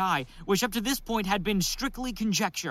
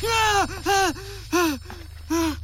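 shocked gasps; 9 semitones higher, slower than usual. Acted. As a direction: gasp as if struck by a vision. Hit by the pineal vision.

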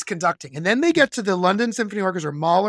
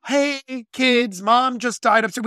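mock spelled-out line; 5 semitones higher, 4 dB louder, slower than usual. Mocking unneeded film dialogue.

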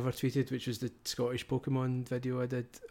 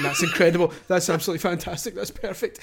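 higher, louder overdone praise; mock gushing; 6 semitones higher, 6 dB louder than usual. Annoyed creator mimicking everyone on Twitter, mock gushing. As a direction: imitate a crowd raving over nothing. Mocking online crowd's praise.

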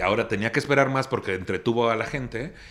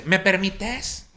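high, very slow, snooty request; mocking haughty woman; 7 semitones higher, much slower than usual. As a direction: stretch it out with fake politeness. Imitating the woman pushing in. (imitación altanera, aguda y muy lenta)

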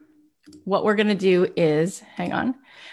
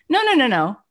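usual speaking voice

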